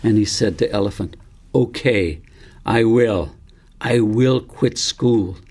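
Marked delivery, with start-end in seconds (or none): 1.5-5.4 put-on a boy's voice, slow and deliberate with resolve; each word of the promise weighed, slowly; slow determined resolve. David tells Elephant he will quit school, slow and resolute.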